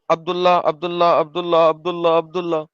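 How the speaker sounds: عبداللہ repeated, the double lam held; pronouncing Abdullah. Shown as a demonstration. Showing his friend how عبداللہ is read. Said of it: "پڑھا بھی ویسے ہی جاتا تھا".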